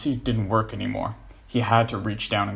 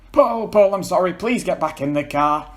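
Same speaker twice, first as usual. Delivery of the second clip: calling out; 8 semitones higher, 6 dB louder than usual. Acted. John calling after Paul.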